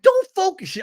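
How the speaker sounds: loud, very high mock cry; high put-on exclamation, possibly faux British. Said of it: "she's just higher pitched".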